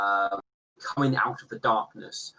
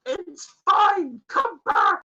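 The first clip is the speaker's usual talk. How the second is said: very high, louder shout; calling out; 6 dB louder, slower than usual. Acted. Ship voice shouts the fleeing player back, very high and loud.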